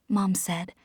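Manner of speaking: plain reading voice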